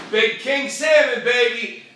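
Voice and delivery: loud, high, drawn-out crow; gloating bear. Acted. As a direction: crow over the catch as the bear. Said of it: "The bear says".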